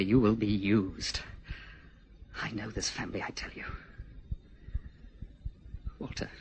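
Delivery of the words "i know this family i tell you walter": whispering. Acted. Confiding warning.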